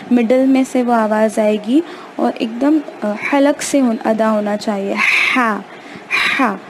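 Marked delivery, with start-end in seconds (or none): 5.4-6.6 ہا breathed hard from the middle of the throat; ہا as Arabic haa from the throat; throaty h. Shown as a demonstration. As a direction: push ہا from mid-throat, a strong breathy h. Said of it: "حلق کے درمیانی حصے سے".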